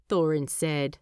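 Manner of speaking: ordinary delivery, nothing marked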